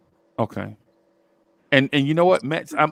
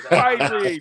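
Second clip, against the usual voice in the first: put-on high, loud Caribbean accent; hammy Caribbean accent exclamation; fake Caribbean accent; 10 semitones higher, 6 dB louder than usual. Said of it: "my fake Caribbean accent".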